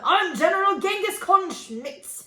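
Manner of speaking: loud hammed-up military commander; bellowing general. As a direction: bellow orders like a blustering general. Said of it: "He bellowed".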